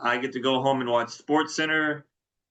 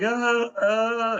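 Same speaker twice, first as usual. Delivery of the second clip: panicked stammer; 10 semitones higher than usual. Retold or imitated. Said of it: "he's like".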